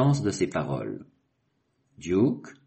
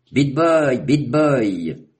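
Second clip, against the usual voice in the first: higher and louder, calling his name twice; 6 semitones higher, 7 dB louder, much slower than usual. A narrator's reading. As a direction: call after him as he leaves. Calling after a departing friend.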